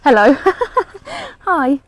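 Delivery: loud, bright hello with a laugh to the dog; loud happy hello. Real, not acted. Greeting her dog in the garden, loud and laughing.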